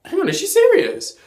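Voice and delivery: high, loud startled gasp of a question; stunned double take; retold shock. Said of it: "Then I'm like".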